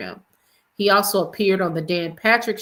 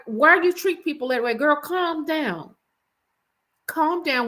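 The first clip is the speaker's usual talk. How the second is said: exasperated scolding; 7 semitones higher than usual. Retold or imitated. Scolding the girlfriend directly.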